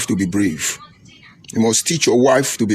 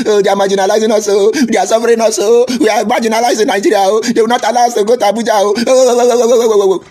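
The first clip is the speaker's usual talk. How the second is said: high, loud, fast mocking whine; mocking wail; 7 semitones higher, 4 dB louder, faster than usual. Commentator mocks the Igbo complaints as a loud whining wail. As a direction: lament like a crybaby, piling on the ohs. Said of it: "They call".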